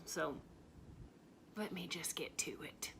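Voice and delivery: hushed confiding whisper. Acted. To the fan club, leaning in with a whisper.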